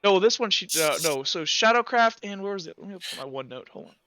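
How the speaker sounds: usual speaking voice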